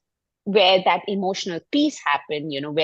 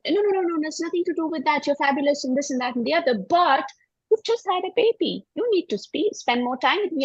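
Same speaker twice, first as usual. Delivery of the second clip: high, fast, breezy brush-off; mock boss; 8 semitones higher than usual. Retelling her boss dismissing her after maternity leave, mocking high fast voice. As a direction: rattle it off like a patronizing boss waving away concern.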